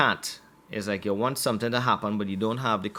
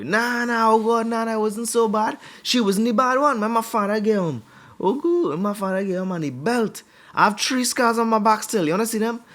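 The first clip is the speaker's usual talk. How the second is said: high, loud protesting; acted excitable storyteller; 11 semitones higher, 5 dB louder than usual. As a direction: insist in a raised pitch as someone swapping beating stories. Acted teller playing down mother's beatings.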